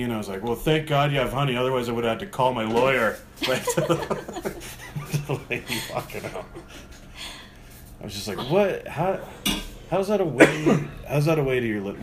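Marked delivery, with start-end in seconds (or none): 0.4-3.2 louder mock-relieved jab; acted-out sarcastic relief; retold sarcasm. Retold sarcastic jab at departing customer. 8.5-12.0 high, loud incredulous questioning; acted-out bewildered indignation; retold disbelief. Retold disbelief at customer's behaviour.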